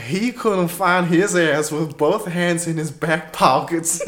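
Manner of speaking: mock country accent, laughing; country western accent. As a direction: read the insult in a laughing twang. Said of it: "country western accent".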